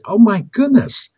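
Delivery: high, slow mock dismay; acted pained astonishment; dismayed husband. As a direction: gasp it out as an appalled husband. Pastor dismayed at the price.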